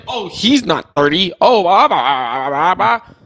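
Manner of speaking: loud, slow self-mockery; mock yourself loudly, drawing out the blahs; mocking own words. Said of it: "I was like".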